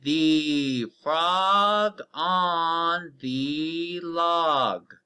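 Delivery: very slow, word by word, each word drawn out; very slow read-aloud. Shown as a demonstration. Teacher reading a sentence to the class, very slowly. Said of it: "Read it with me".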